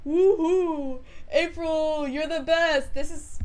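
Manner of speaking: hammed-up screaming fan; throat-straining cheer. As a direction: whoop and yell praise up at the stage. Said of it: "my poor throat".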